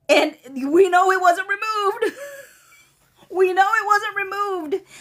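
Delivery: high-pitched, laughing insistence; amused disbelief. Real, not acted. Host mocking a note about a removed line, laughing.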